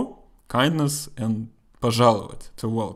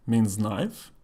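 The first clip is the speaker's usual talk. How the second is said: ordinary talk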